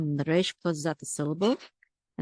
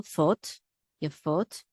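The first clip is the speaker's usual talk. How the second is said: fot alone, then yefot leaning on FOT; stress on last syllable; much slower than usual. Shown as a demonstration. Teacher showing where the accent is, last-syllable stress on yefot. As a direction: put the weight of yefot on its final fot. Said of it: "the accent is on the last syllable".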